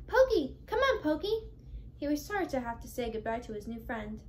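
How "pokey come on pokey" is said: high, loud call across the woods. Acted. Sister Pickles calling Pokey home through the woods, high and loud. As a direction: call him home from far off. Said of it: "Pickles call".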